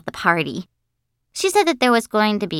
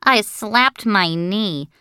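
ordinary delivery, nothing marked

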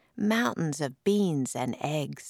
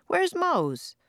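worried call; 13 semitones higher than usual. A narrator's reading. Firefighter alarmed, searching.

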